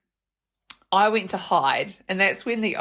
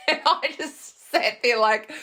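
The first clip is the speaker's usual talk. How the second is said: laughing; 5 semitones higher than usual. Real, not acted. Laughing at absurd situation.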